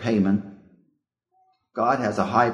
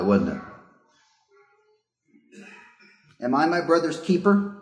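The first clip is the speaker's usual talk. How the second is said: raised, lifted Bible reading voice; scripture reading; 4 semitones higher than usual. Acted. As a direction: read the verses out like scripture in church. Preacher reads scripture aloud.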